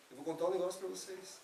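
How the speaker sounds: usual speaking voice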